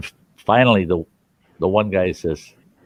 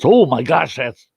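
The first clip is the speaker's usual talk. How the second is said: shocked exclamation; 6 semitones higher, 4 dB louder than usual. Acted. Punchline reaction of shocked trapper.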